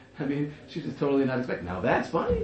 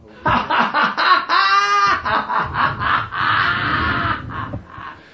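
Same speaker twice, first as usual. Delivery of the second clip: long, loud, high roaring laugh; roaring crowd laugh; 13 semitones higher, 6 dB louder than usual. Acted. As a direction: laugh like a whole room on the floor.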